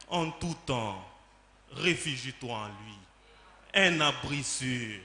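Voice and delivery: soft, low, soothing recitation; reassuring refrain. Acted. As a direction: drop down to a calm, comforting invitation. Gentle refrain urging refuge in God. (refrain apaisant, à voix basse et douce)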